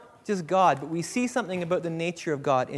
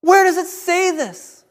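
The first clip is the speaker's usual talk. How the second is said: acted furious rebuke, high and loud; shouted challenge in separate, drawn-out bursts; anger; 9 semitones higher, 11 dB louder than usual. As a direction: thunder at the false representatives in outrage. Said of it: "the anger is coming out".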